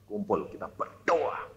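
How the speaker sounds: low, soft request to gather; retold hushed invitation. Child inviting siblings to pray, low and soft. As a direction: say it softly, pitched low. Same call repeated softly.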